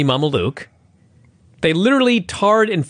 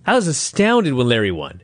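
ordinary delivery

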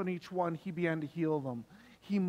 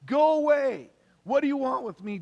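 loud, high-pitched shout; crying out; 7 semitones higher, 7 dB louder than usual. Acted. Possessed man to Jesus in the synagogue, crying out. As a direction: cry out at the top of your voice. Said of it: "cried out at the top of his voice".

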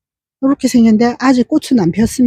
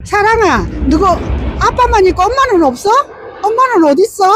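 high, sing-song cooing to a pet; 9 semitones higher than usual. Real, not acted. Owner cooing at her dog Sarang in high baby talk. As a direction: coo sweetly at the little dog.

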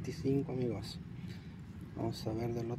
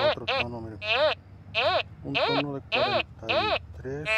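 excited; 10 semitones higher, 4 dB louder than usual. Real, not acted. Announcing a second detector tone to viewers, excited. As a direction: lift the voice up as the signal comes.

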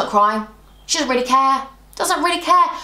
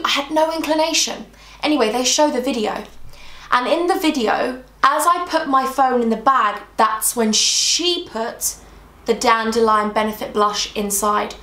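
ordinary talk

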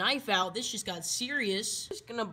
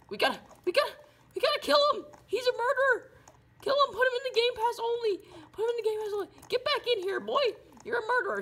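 much higher and louder frantic shouting; frantic yelling; 13 semitones higher, 4 dB louder, much faster than usual. Acted. Calling on everyone to kill the murderer, frantic yelling. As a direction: shout in a panic to catch him.

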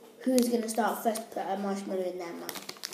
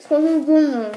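cheeks stuffed full; 4 semitones higher, 11 dB louder than usual. Real, not acted. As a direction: mouth crammed with marshmallows. Chubby bunny marshmallow mouthful challenge.

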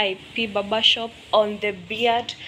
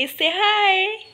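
much higher, bright coaxing call; cheerful prompt; 11 semitones higher than usual. Real, not acted. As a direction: coax them into waving hello. Prompting staff to greet camera.

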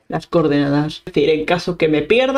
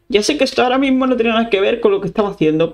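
ordinary delivery

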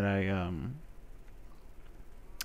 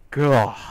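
frustrated gah; 4 semitones higher, 12 dB louder than usual. Real, not acted. Frustrated outburst.

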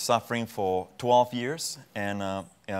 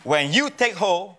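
raised, louder preaching call; higher and louder on let go; preaching peak; 8 semitones higher, 6 dB louder than usual. Real, not acted. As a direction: lift it like a sermon climax. Preaching climax.

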